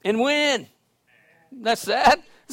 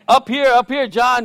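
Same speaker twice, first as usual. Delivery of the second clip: high, loud retold call to someone below; 6 semitones higher, 6 dB louder than usual. Pastor calls homeless man to look up, calling out. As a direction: call down to get his attention.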